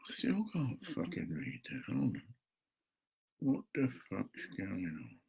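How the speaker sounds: very soft, frustrated mutter; muttering to himself. Real, not acted. Man alone struggling to read his bank card, muttering softly. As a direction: swear under your breath, barely audible.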